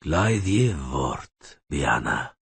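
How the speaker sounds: very slow, even elvish command; calm voice. A narrator's reading. Elf rider calmly ordering the mercenary to yield. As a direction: speak it with untroubled calm. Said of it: "in a calm voice".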